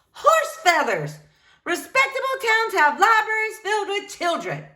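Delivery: high, spirited dismissal; defiant retort. Acted. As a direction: snap back feisty and proud.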